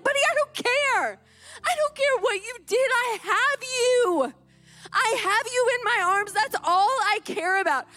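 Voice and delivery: put-on emphatic, emotional outpouring to a child; impassioned reassurance. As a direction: pour out relieved love, reenacting the reunion. Mother embraces found child, overcome.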